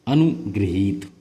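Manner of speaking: अनुगृहीत said slowly with गृ and long ही; correct form, slow. Shown as a demonstration. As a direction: stretch out अनुगृहीत carefully. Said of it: "ग्रहित नहीं गृहीत होता है". (शुद्ध अनुगृहीत धीरे से)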